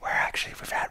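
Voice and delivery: hushed, breath-only reply; soft mock-discreet whispering; imitated whisper. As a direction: whisper it as a pointed hint. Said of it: "you kept whispering to him".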